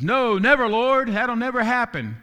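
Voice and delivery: loud, high, insistent no; emphatic protest. Retold or imitated. Preacher voicing Peter's loud protest.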